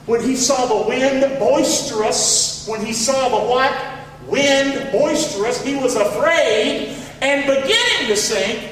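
slow, emphatic preacher's verse reading; scripture reading. Acted. Preacher reading Peter's verse to congregation, slow and emphatic. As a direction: read the verse out slowly, stretching key words.

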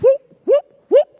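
high, loud whoop like a heron call; bird whoop. Pretend. Preacher imitating the herons' call above his head. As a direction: mimic the herons flying over.

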